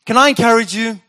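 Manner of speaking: ordinary talk, nothing marked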